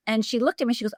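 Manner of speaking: usual speaking voice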